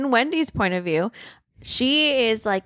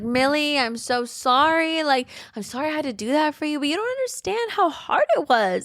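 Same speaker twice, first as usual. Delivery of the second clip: high-pitched acted-out pleading; 7 semitones higher than usual. Wendy apologizing to Millie to save herself, pleading. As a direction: reason with her in a raised, whiny pitch. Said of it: "trying to reason with her".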